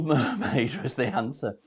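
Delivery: usual speaking voice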